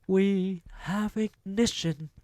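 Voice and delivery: slow triumphant witch voice; Grand High Witch. A narrator's reading. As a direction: declare it like a gloating witch. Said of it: "witch ends countdown".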